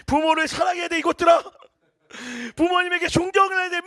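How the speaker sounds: loud, high preaching at the youth; preaching shout. Retold or imitated. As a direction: yell it like a fired-up youth preacher. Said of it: "막 이러면서 설교했단 말". (청년들에게 소리치듯 설교하는 목소리)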